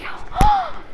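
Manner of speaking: high, mock-delighted gasp; big acted gasp of happy surprise; excited gasp. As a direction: imitate a thrilled sharp intake of breath. Sister's happy reaction, acted out.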